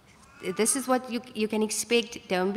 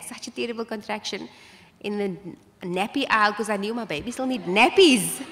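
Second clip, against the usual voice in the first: ordinary talk, nothing marked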